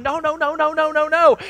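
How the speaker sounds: rapid-fire, high, loud string of no's; urgent calling out. Retold or imitated. Coach calling off stealing runners.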